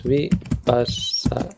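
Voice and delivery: "vipassana" slowly, syllable by syllable; split into syllables. Shown as a demonstration. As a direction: break "vipassana" into vi, pas, sa, na. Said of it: "If we split this up".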